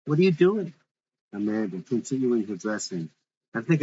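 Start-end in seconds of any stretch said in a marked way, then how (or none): 0.0-0.7 jumps up high and loud, eager; startled lover's eager question, high and loud; excited though soft. Acted. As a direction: blurt it out all excited. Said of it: "excited though soft".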